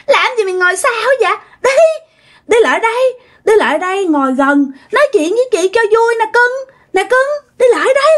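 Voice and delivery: very high, screechy coaxing; shrill possessed sister-in-law. Retold or imitated. Possessed sister-in-law urging the girl to sit by her, shrill and creepy. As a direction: coax insistently in a thin, piercing voice. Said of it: "cái giọng của chị á là the thé".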